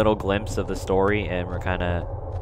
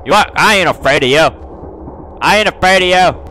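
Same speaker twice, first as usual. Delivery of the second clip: loud bravado, rising higher on the repeat; defiant shout; 5 semitones higher, 7 dB louder than usual. Real, not acted. Player facing down the ghost girl, loud defiance. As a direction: stand up to it loudly.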